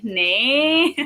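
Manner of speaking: playful drawn-out "nay"; joking tag. Shown as a demonstration. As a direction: swing "nay" up long, as a tease. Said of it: "in, like, a joking way".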